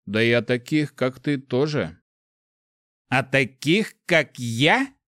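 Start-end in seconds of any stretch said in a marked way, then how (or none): 3.1-4.9 the echoed words thrown back, higher and menacing; с угрозой; flaring fury. A narrator's reading. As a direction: flare up, mildly menacing. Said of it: "Голос вспыхнул яростью".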